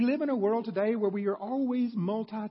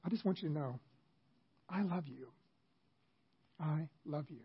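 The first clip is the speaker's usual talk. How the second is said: a quiet heartfelt declaration to a family member; tender I love you; 7 dB quieter than usual. Shown as a demonstration. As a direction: say it gently, looking them in the eyes. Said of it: "say to them with your own words".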